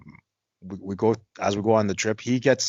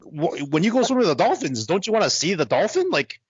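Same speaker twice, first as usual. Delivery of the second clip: incredulous; 7 semitones higher than usual. Retold or imitated. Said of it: "I'm like".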